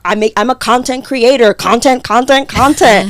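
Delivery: loud, pushy content-creator voice; mock self-promotion. Woman mocking influencers, loud and pushy. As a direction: hammer content like a sales pitch. Mocking LinkedIn style posts.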